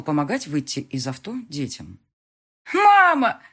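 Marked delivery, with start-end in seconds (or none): 2.7-3.4 put-on high, loud child's cry of Mama; little girl yelling for her mother; daughter shouting. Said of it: "закричала дочь".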